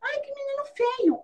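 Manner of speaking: put-on high-pitched tattling child voice; squeaky-high mock child teasing; mocking little girl. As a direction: imitate a little girl complaining to mom. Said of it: "a menininha começou a dar risada".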